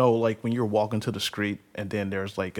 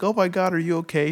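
higher, alarmed lady voice; worried lady; 8 semitones higher, 4 dB louder than usual. Retold or imitated. Passing lady finds the biker on the highway, alarmed. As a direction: gasp it out like a startled stranger. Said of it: "She's like".

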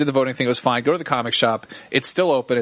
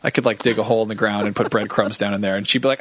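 ordinary talk

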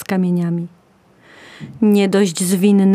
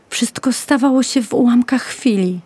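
plain reading voice

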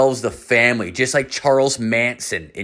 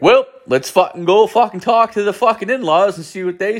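much higher-pitched, sustained rallying cry; retold drunken bravado; 10 semitones higher than usual. Tipsy teller psyching himself up to face the in-laws, mock bravado. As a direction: psych yourself up, pitched high.

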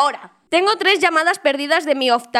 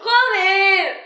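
exclaiming; 6 semitones higher, 4 dB louder than usual. Real, not acted. Reacts to joke failing.

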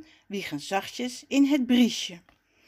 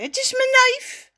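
high, loud, amazed; astonished call; 7 dB louder than usual. A narrator's reading. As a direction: call out the surprising discovery. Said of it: "roept hij". (verbaasd roepen, hoog en luid)

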